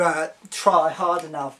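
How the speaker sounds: normal talking voice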